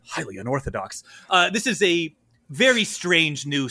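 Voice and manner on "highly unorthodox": low, hushed courtier murmur; whispered murmur. Acted. Courtiers uncomfortable with the new emperor, murmuring low. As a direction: murmur it softly behind the ruler's back. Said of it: "you hear whispers of".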